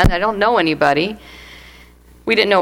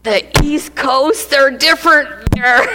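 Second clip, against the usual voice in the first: slow, higher alarmed Maryland; mock dismay; dismayed surprise; 5 semitones higher, slower than usual. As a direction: stretch out the shock at moving east. Wife reacting to Maryland move.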